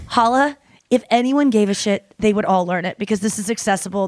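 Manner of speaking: normal talking voice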